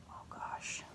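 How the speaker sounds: breathy aside, barely voiced; whispered aside. Real, not acted. Reader whispering a worried aside to the child. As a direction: whisper it to the listener.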